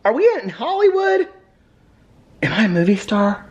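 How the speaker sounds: high, starry-eyed dog voice; voicing the dog. Owner voicing her dog wanting to be a star, in a high excited voice. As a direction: dream aloud up high as the little dog. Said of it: "He said".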